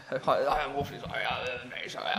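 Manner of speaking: exaggerated, mumbled English accent; hammed-up English apology. Alfred apologising to the woman, very English. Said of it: "in very English".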